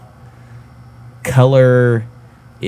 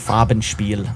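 German accent; 3 dB quieter, slower than usual. Shown as a demonstration. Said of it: "a German accent every time we say it".

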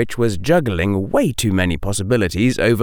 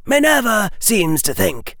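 high-pitched, stern elder voice; old witch; 12 semitones higher than usual. A narrator's reading. Old witch addressing Harry about Minerva's opinion, high stern voice. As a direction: speak as a formidable witch, pitch well up. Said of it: "the old witch said".

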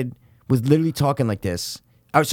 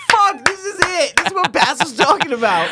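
retold viewer alarm; 13 semitones higher than usual. Telling friend how he watched the spoiled scene, high mock alarm. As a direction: cry it out high, reliving the shock. Retells reaction to the death.

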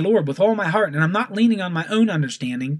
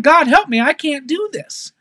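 desperate prayer, pitched up at the start; earnest, strained-with-effort appeal that gradually settles; wholehearted plea; 8 semitones higher, 5 dB louder than usual. Acted. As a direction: cry out to God for help. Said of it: "coming to God with my whole entire heart and saying".

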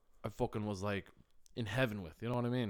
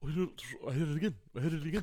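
fast, higher, garbled put-on voice; imitated friend's mumble; 5 semitones higher, much faster than usual. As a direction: gabble it quickly, mimicking your mate. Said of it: "mentioning something like".

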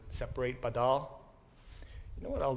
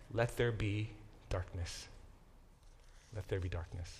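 Job lamenting; 5 semitones lower, 8 dB quieter than usual. Acted. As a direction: recite it sombrely, sinking into near-silence. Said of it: "you're in absolute agony physically".

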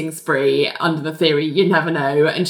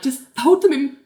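exasperated; 8 semitones higher than usual. Retold or imitated. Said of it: "it's like".

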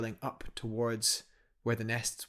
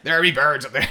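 loud, hammed-up Gaelic Scottish accent, much louder; terrible Gaelic Scottish accent; 3 semitones higher, 11 dB louder, faster than usual. Imagining a Saint Kildan climber spotting nests, bad Gaelic Scottish accent. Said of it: "a really strong Gaelic Scottish accent".

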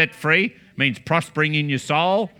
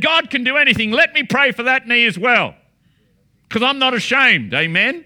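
fervent preaching; 3 semitones higher than usual. Acted. Preacher telling the congregation Jesus is the only way, fervently. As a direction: proclaim it boldly to the crowd.

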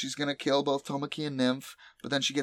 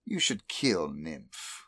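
low, slow voice of a master; mock-ominous command; 6 semitones lower, slower than usual. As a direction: give the order low and deliberate. Master's order retold.

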